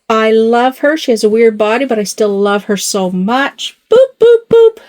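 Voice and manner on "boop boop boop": high, loud playful boops. Real, not acted. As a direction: bop the doll's nose with a squeaky boop. Playfully booping the doll's nose.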